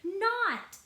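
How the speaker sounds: a high, loud shouted "Not"; screamed back. A narrator's reading. Sister screaming back at her brother in a squabble. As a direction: fling it back at him in a yell. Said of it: "I screamed back".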